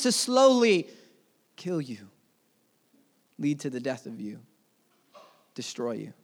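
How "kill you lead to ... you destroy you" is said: dropped low and soft, heavy; hushed and grave. Acted. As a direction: let each phrase sink quietly. Temptation slowly destroying you.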